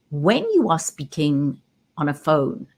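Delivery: ordinary talk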